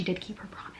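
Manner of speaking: hushed, secretive whisper; real whisper. Real, not acted. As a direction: lean in and whisper the twist. Hushed telling of the surprise.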